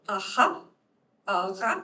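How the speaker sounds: a ha, a ra scraped at the back of the throat; throaty r. Shown as a demonstration. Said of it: "I think that's more uvular".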